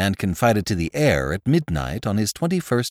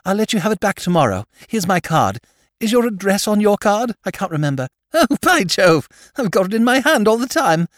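much higher and louder, fast, tumbling silly-ass chatter; excited young lord; 11 semitones higher, 4 dB louder than usual. Acted. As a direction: rattle on in a rush, delighted. Lord Drever grabs the note.

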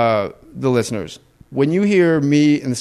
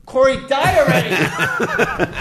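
laughing shout; 11 semitones higher, much slower than usual. Acted. Mock death wish at cougher.

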